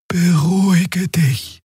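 calming Xia. A narrator's reading. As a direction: ease him down slowly. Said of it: "sagte die Draxül".

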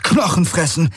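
calling out. A narrator's reading. Garland spotting the insect swarm, high startled cry. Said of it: "rief er aus".

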